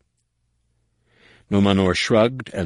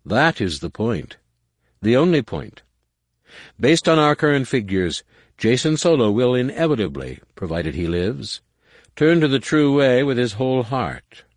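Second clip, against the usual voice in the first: eager, confident advisor; smooth schemer; 8 semitones higher, 4 dB louder than usual. Acted. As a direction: sell the plan with zeal. Nomanor's confident pitch.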